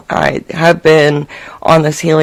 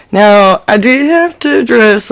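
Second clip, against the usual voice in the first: normal talking voice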